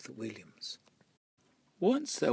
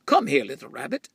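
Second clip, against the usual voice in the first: loud groundhog; 10 dB louder, faster than usual. Acted. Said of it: "he said".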